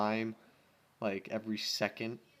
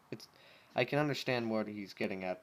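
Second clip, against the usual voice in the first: usual speaking voice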